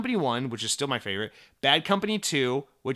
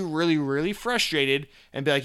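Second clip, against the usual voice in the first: ordinary talk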